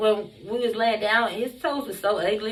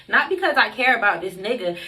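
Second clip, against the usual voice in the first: usual speaking voice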